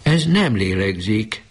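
high, slow, trembling words of disbelief; mother realising her child isn't breathing. A narrator's reading. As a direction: say it as if you can't believe it. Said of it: "csodálkozó, reszkető hangon".

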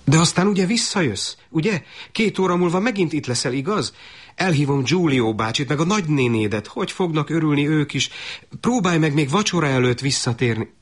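hopeful mother. Acted. Mother planning her son's homecoming dinner, high and eager. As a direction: chatter on hopefully, a mother who won't let go. Mother begs son to return.

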